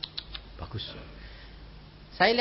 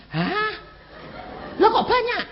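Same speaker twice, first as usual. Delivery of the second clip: stunned disbelief. Retold or imitated. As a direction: laugh out in disbelief at the sum. Shocked at huge sum. (kaget tak percaya sambil tertawa)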